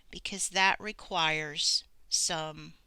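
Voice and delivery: ordinary talk, nothing marked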